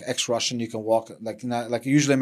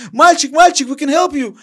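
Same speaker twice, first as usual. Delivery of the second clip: much higher and louder calling out; mock bystander call; 12 semitones higher, 8 dB louder than usual. Bystanders to the hit boy, loud high call. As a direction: call out to the fallen boy, eager to help. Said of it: "And they're like".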